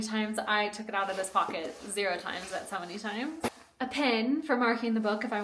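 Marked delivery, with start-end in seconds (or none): none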